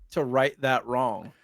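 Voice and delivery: ordinary talk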